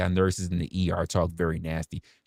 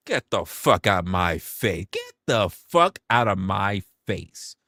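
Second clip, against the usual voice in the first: slow, drawn-out get out of my face; exasperated; slower than usual. Real, not acted. Telling sneaky nurses off, slow and exasperated. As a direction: stretch it out in disbelief.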